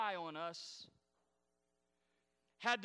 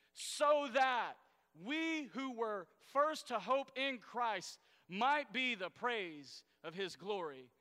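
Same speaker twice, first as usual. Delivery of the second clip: louder, higher declaiming of the verse; slow down and land the final words; scripture read with force; 3 semitones higher, 5 dB louder than usual. Acted. Pastor reads Ephesians aloud to the church, declaiming.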